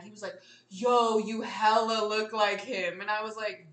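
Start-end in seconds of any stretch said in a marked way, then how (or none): 0.7-3.0 loud, slow exclamation of recognition; retold amazement. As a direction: imitate his astonished reaction, drawing it out. Voices the guy's amazed recognition.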